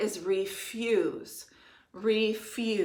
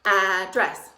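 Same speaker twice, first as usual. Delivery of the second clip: pounding the word; 7 dB louder than usual. Shown as a demonstration. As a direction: Address said loud and punched, syllable by syllable. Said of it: "Pound with me one more time".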